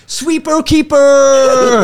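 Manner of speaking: very high, loud, drawn-out yell; mock fan shout. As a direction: bellow it into someone's face. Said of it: "pointed in my face and went".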